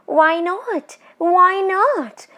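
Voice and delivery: high, eerie teasing spirit voice; a tall witch toying with her prey; ghost woman. Acted. Ghost woman teasing the frightened Bishan Singh, eerie and high.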